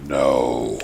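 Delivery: low, drawn-out "no"; mean refusal. A narrator's reading. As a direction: refuse coldly in a deep, low register.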